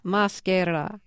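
"Maschera" copied carefully after the coach; tentative echo of "Maschera"; learner's repetition. Shown as a demonstration. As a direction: try "Maschera" in Italian, rising at the end. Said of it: "Say maschera".

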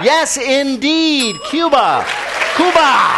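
high drawn-out Cuba cry; excited. Acted. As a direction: stretch Cuba out, high and loud. Host exclaims the answer dramatically.

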